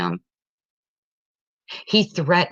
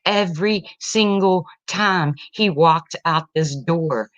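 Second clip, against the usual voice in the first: ordinary talk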